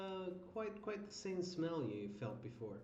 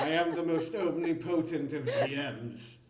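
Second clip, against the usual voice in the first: booming demon lord; 3 semitones higher, 7 dB louder than usual. Pretend. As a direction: boom it out like an almighty overlord. GM proclaiming himself all-powerful.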